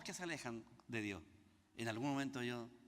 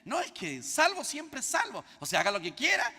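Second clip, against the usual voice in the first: mock-careless quote, higher and louder; mocking the careless believer; 9 semitones higher, 6 dB louder than usual. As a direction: mimic the careless believer's excuse with a sneer.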